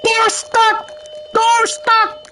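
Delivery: very high, strained cartoon squeal; meme voice. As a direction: shriek it like a panicked cartoon. Quoting a meme at a stuck door.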